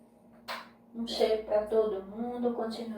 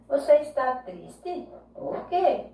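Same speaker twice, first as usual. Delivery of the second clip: higher, louder questioning of Você está triste? Por quê?; acted question; 4 semitones higher, 4 dB louder than usual. Shown as a demonstration. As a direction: ask it like a worried friend. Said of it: "final de frase interrogativa".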